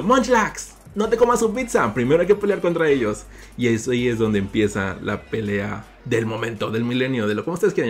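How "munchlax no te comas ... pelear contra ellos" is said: high, loud shout at his pet; yelling. Acted. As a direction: shout it across the restaurant. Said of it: "salgo yo gritando". (gritando alto al Munchlax)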